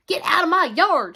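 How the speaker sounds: loud, high-pitched angry yell; shout. A narrator's reading. Eugenia chases Mercy across the yard, shouting. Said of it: "shouted Eugenia".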